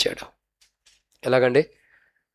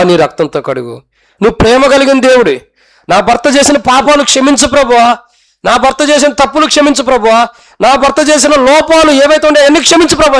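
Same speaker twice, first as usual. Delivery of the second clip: fervent prayer; 9 semitones higher, 6 dB louder than usual. Acted. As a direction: beg God louder and louder until it is a shout. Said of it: "ప్రార్థన చేస్తుందంట".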